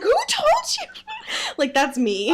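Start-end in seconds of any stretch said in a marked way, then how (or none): 0.0-0.9 high-pitched mock outburst, laughing; comically high squawked question through laughter; Stanley Tucci. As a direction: imitate a startled dad, pitched way up. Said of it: "and he's like".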